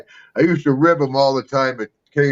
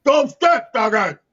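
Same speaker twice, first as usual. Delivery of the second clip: shouting; 6 semitones higher than usual. Retold or imitated. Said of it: "You're like".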